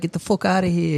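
put-on light New York accent on a brash line. Friend showing off a New York accent, lightly done. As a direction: toss it off like a New Yorker, a hint of the accent. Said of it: "they talk like that with the mother".

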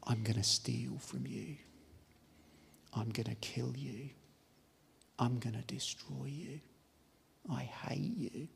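a hint of a sinister whisperer; menacing devil voice. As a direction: let the hate seep out quietly. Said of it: "this is what is behind that voice".

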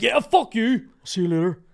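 high, loud mock-angry shout; aggressive goodbye. Worker acting out an awkward goodbye to the manager, comically aggressive. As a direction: yell a hostile cheerio, hammed up. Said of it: "Except aggressive".

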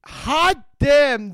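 usual speaking voice

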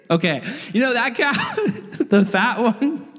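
high-pitched, giggling through the words; laughing. Real, not acted. Preacher resumes dad's orders, laughing. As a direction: try to go on while cracking up. Cracks up while resuming the story.

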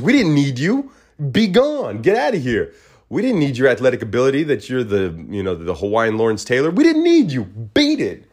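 high-pitched, hammed-up brush-off getting shriller; mock dismissive rant. USC fan telling Manti Te'o to get lost, hammed-up shrill dismissal. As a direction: wave him away in overblown spite.

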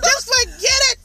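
high, squealed mock surrender; flustered giving up. Dater deleting the whole profile, flustered squeal. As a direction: throw it away in a high, flustered cry.

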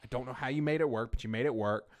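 ordinary delivery

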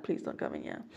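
hushed whisper to someone at the door. Real, not acted. Vlogger whispering to someone at the door. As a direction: whisper it so the mic barely hears.

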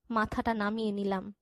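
ordinary delivery

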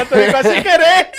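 high, loud, laughing through words. Real, not acted. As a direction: crack up mid-sentence. Near slip of friend's name.

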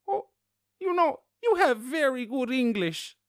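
put-on loud, slow, very high old-lady voice; old woman. Old woman complimenting a stranger's English, high mock voice. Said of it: "this very old woman next to me".

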